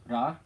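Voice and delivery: ra with a light flick of the tongue; slightly rolled R. Shown as a demonstration. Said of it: "Slightly rolled R".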